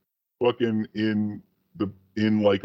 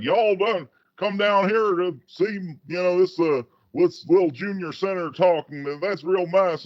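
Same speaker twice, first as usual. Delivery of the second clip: fake Southern accent; 9 semitones higher than usual. Obama mocked as putting on a Southern accent to students. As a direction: ham up a Southern accent to a college crowd. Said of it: "he was talking with, like, a Southern accent".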